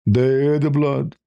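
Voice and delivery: ordinary delivery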